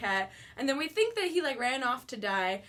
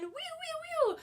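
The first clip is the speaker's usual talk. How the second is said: high, playful "wee"s; excited squeal; 13 semitones higher, 5 dB quieter, slower than usual. Real, not acted. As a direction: squeal with delight about the trip. Cheering about going to VidCon.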